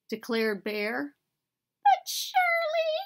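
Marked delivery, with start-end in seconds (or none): 1.8-2.9 very high, squeaky mouse protest; little Mouse. A narrator's reading. Mouse objecting to Bear's pickles, in a squeaky plea. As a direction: plead in a tiny high mouse voice. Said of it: "said Mouse".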